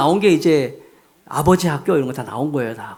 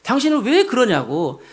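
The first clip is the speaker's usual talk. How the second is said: imitating angry wife; 7 semitones higher than usual. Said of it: "막 화를 내면서".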